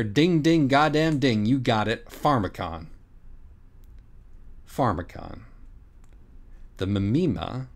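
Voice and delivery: normal talking voice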